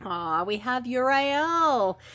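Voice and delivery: normal talking voice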